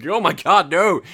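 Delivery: bursting out laughing at himself. Real, not acted. Laughing at his own drowning joke, loud. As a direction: laugh through it.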